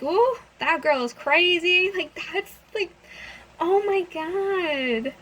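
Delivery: high, drawn-out incredulous reaction; mock disbelief. Reacting once the crazy girl leaves, mock disbelief. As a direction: let it out as a stretched, high exclamation.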